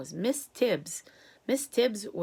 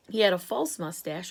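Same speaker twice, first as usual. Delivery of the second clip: plain reading voice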